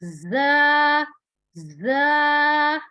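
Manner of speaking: जा firm with whistling z; firm zaa. Shown as a demonstration. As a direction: press जा hard, let it hiss. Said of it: "सख्ती से और सीटी की आवाज".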